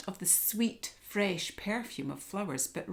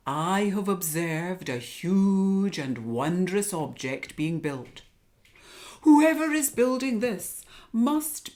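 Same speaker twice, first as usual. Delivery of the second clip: wise owl voice; 6 dB louder than usual. Acted. Owl speaks.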